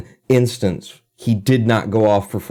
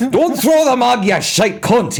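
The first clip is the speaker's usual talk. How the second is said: put-on much higher, louder Scottish accent; 3 dB louder, slower than usual. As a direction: spit it out like a Trainspotting character. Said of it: "in the accents from Trainspotting".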